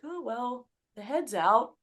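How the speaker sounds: casual husband delivering news; matter-of-fact report. Retold or imitated. Husband to wife in labor, matter-of-fact. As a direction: imitate an understated announcement.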